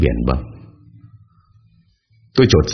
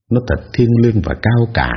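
ordinary delivery

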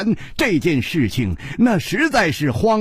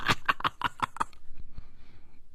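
helpless laughter; 13 dB quieter than usual. Acted. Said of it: "他笑倒在短榻上".

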